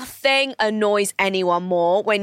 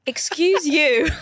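higher, drawn-out retort; retold sassy comeback; 6 semitones higher, much slower than usual. Teller answering back to a rude stranger, pointed and slow. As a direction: throw it back slowly and pointedly. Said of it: "And I go".

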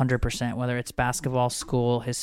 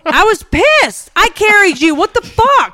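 high, loud mock-outraged yell; indignant outburst; 11 dB louder, slower than usual. Mother protesting that she carried her baby, indignant yell. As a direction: shout it in exaggerated indignation.

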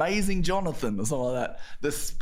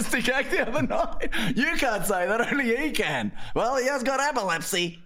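high-pitched mock audience chatter, laugh creeping in; gossiping audience members; 7 semitones higher than usual. Comedian acts out punters discussing the act, high mock voices. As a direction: play scandalised punters in a raised voice.

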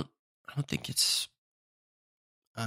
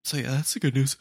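ordinary delivery

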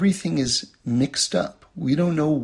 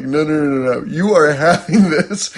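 louder, firm no-nos breaking into a laugh; insisting; 2 semitones higher, 8 dB louder than usual. Retold or imitated. Parent insisting the baby eats it, firm then laughing. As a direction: put your foot down, half laughing. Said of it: "I was like".

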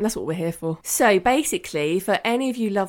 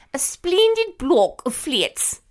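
exaggerated upper-class English royal accent, vowels pinched into "spleendid" and "fleets"; Princess Margaret; 8 semitones higher, slower than usual. Quoting a princess opening flats, mock-royal English accent. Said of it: "but she didn't say it like that".

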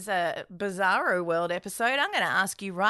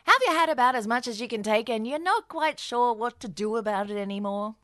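ad read; 4 semitones lower than usual. Acted. BetterHelp ad read by both.

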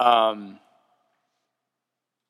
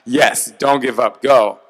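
loud, slow cheer; 8 dB louder, slower than usual. Retold or imitated. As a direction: shout them on like a coach. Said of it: "I'm like".

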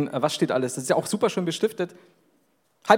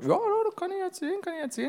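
quick, much higher eager thought; inner thought; 14 semitones higher, 4 dB quieter, faster than usual. Retold or imitated. Recalling an excited thought on the way in a quick, high voice. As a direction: rattle it off high and pleased. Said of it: "wo ich mir gedacht hab".

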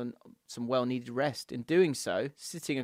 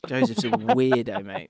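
teasing emphasis; 6 semitones higher, 5 dB louder, slower than usual. Real, not acted. Repeats the verdict louder.

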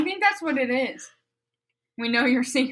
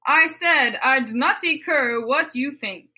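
lilting mock-Swedish reading; Swedish Chef accent; 2 semitones higher than usual. Reading misspelled fan fiction to co-hosts, Swedish Chef accent. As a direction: read it like a bad PewDiePie Swedish impression. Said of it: "in the accent of Swedish Chef".

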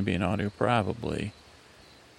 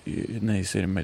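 ordinary delivery

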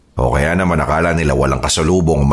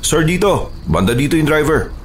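man down shout; 11 semitones higher than usual. Acted. Rescuer calling at crash site.

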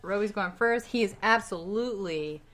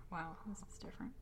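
whisper; 15 dB quieter, faster than usual. Real, not acted. Aside while reading question.

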